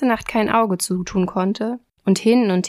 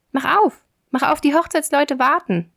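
higher-pitched storybook hare; urging, raised voice; calling hare; 6 semitones higher than usual. A narrator's reading. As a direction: call through the door as the little hare. Hare calls at the door again.

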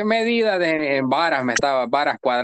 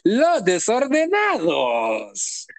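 slow ceremonial announcer voice; grand announcement; 5 semitones higher, much slower than usual. Acted. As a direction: proclaim it like a title. Announcing the fans' nickname.

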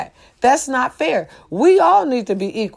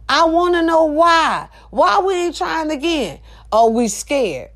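ordinary talk

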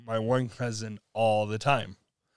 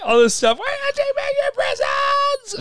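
high, loud furious yell; mom losing it; 4 dB louder than usual. Retold or imitated. Mom yelling at her son about the mirror. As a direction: explode at your kid. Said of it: "she loses her crap".

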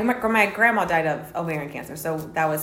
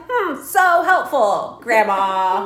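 sarcastic; 5 semitones higher, 3 dB louder, slower than usual. Retold or imitated. Sarcastic reply to grandma.

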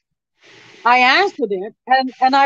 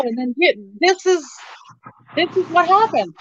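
usual speaking voice

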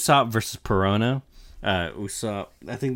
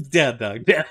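mock dog command; 4 semitones higher, 6 dB louder than usual. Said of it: "He's like".

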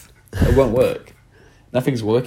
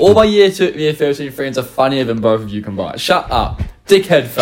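mock British accent; 2 semitones higher than usual. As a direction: play a scornful British teen accent. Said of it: "doing a British accent".

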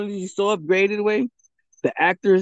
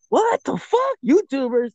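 much higher, stunned outburst; retold disbelief; 8 semitones higher, slower than usual. Recounting his kids calling actors YouTubers, high mock outrage. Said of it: "I'm like".

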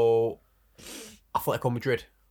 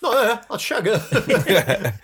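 slow, high, loud and laughing; laughing mock villager; 10 semitones higher, 6 dB louder, slower than usual. Mock villager asking for the virgin, slow laughing high voice. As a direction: ham up a randy villager through laughter.

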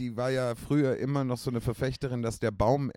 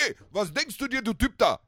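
put-on Yugoslav accent; 12 semitones higher than usual. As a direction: shout it across the gym in a Yugoslav accent. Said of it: "so einen ganz starken Akzent".

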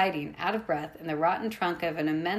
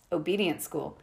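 plain reading voice

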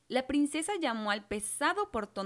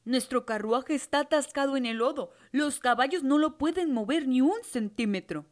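worried coachman; 2 semitones higher, 6 dB louder than usual. A narrator's reading. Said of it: "preguntó el conductor".

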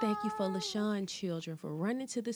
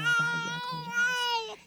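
high quavering voice; 5 dB louder than usual. Acted. Starting the prayer, voice cracks.

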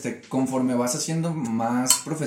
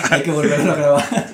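laughing; 4 semitones higher, 8 dB louder than usual. Real, not acted. Joking about off-key take.

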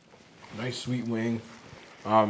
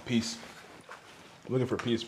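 usual speaking voice

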